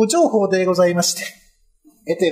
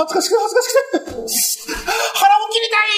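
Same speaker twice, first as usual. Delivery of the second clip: wailing in shame; 12 semitones higher, much faster than usual. Acted. Retainer wails in shame.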